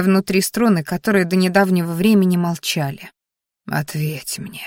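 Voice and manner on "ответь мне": hushed low plea; whispering. A narrator's reading. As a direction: breathe it out quietly, close to her ear. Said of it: "шептал боевик".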